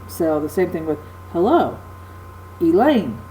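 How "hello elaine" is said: hello, Elaine, each landing on its second syllable; weak-strong beat on hello and Elaine; stress on second syllable. Shown as a demonstration. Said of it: "syllable is accented".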